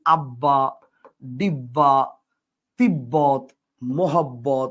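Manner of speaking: low, measured list with every double b held; distinct double ব in each word, from আব্বা to মোহাব্বত; clearly sounded b. Shown as a demonstration. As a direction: press fully on the bb in আব্বা, ডিব্বা, তিব্বত, মোহাব্বত. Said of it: "এটার উচ্চারণটা হচ্ছে একদম সুস্পষ্ট".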